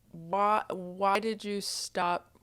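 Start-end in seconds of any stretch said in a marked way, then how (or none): none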